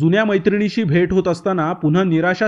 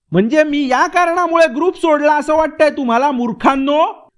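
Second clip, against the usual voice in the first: disgusted outburst; 7 semitones higher than usual. Acted. Vrushali scorns her old friends for suspecting her, disgusted. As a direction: scoff at them and call them fools. Heated money quarrel between friends.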